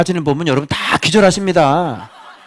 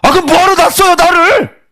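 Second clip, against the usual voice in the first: higher, louder sulky outburst; resentful child yelling at dad; angry kid; 14 semitones higher, 6 dB louder than usual. Acted. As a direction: snap back at a parent like a fed-up teen. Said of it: "애들이 열받아서".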